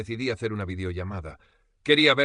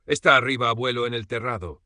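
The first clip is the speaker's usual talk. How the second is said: put-on matter-of-fact child; 3 semitones higher, 7 dB louder than usual. A narrator's reading. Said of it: "como si fuera lo más normal del mundo".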